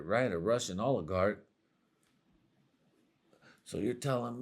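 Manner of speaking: soft, stammering disbelief; quiet, halting incredulous questioning; baffled muttering. Acted. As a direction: trail off, stumbling in confusion. Stunned guy questions the fan.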